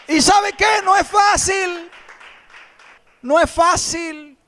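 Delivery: high, loud, shouted sermon; fiery preaching. Acted. Pastor urging the congregation, shouted preaching. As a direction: preach it at full force to the church.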